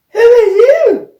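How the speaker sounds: very high, loud cry of surprise; startled question. A narrator's reading. Player finding a tiny man beside them, startled. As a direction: blurt it out loudly in shock.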